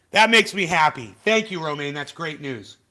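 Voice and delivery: usual speaking voice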